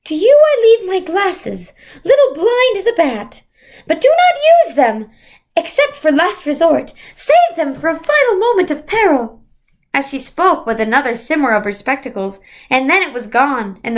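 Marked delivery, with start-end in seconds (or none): 0.1-9.4 much higher, louder distant voice; Mrs Who's voice. A narrator's reading. Mrs Who leaves Meg her glasses, high and otherworldly. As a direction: hand over a gift like a wise spirit.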